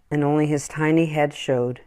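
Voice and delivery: usual speaking voice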